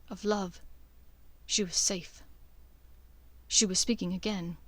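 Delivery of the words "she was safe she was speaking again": plain reading voice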